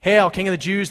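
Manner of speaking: mocking. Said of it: "they mocked".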